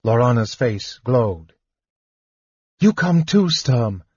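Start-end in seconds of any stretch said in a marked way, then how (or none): 2.8-4.0 higher, eager urging; keen and pleading; childlike eagerness. A narrator's reading. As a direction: urge him along like an excited child. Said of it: "tugging at him like a child".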